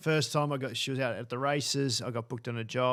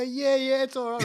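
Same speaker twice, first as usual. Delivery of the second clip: high, loud cover-up reassurance; mock panicked reassurance; 12 semitones higher, 7 dB louder than usual. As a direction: blurt a hammed-up "all fine" to hide the mistake. Hastily covering up burnt hair.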